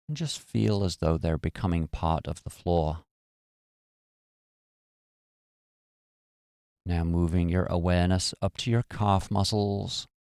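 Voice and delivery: calm, even meditation voice with long pauses; guided meditation. Acted. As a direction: guide them gently into relaxation, phrase by phrase.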